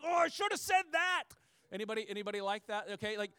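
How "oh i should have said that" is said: higher, louder sudden aha; hammed-up hindsight exclamation; mock realization. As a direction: act out the too-late comeback. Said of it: "I'm like".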